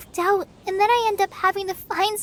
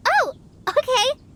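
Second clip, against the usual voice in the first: a flustered, startled okay, pitched up high; surprised; 9 semitones higher, 3 dB louder than usual. Acted. Collei caught off guard by Sucrose's odd invention names, flustered surprise. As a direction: caught off guard, then going along with it. Taken aback by Tetratanic names.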